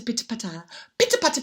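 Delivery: ordinary delivery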